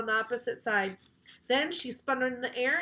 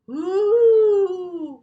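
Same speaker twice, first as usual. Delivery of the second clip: loud high group ooh of alarm; a crowd gasps at the swipe; gasping ooh; 9 semitones higher, 13 dB louder than usual. A narrator's reading. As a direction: ooh of shock, much higher and louder. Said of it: "we all went".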